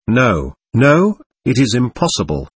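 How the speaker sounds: usual speaking voice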